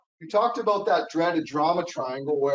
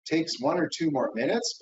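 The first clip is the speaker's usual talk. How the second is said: ordinary talk, nothing marked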